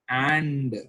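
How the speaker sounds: "and" drawn out and set apart; a long, emphatic "and" standing alone; stress on "and". Shown as a demonstration. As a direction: lean hard on "and", stretching it. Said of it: "I'm emphasizing on the word and".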